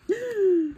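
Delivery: sharp high gasp of delight; excited gasp. Real, not acted. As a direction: gasp at a happy discovery. Discovers forgotten paper, excited gasp. (halètement de surprise ravie)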